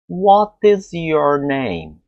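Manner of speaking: carefully articulated model; slow model. Shown as a demonstration. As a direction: stress on is, every word stretched. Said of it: "Falam pra mim assim".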